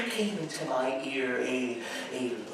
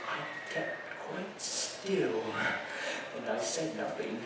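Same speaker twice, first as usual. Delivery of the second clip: much softer, barely above a breath; hushed; 3 semitones lower, 11 dB quieter than usual. Acted. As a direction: hold your breath as you say it. Narrator describes keeping still.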